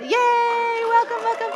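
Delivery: high, loud joyful yay; excited cheer. Real, not acted. Rabbi cheering first-time visitors, high and loud. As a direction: cheer the newcomers in with delight.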